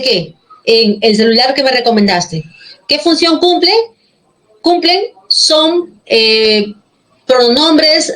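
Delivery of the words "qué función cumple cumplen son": ordinary talk, nothing marked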